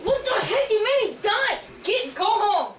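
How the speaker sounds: loud furious shouting; raging gamer. Real, not acted. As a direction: scream at him to go home. Yelling at friend to leave.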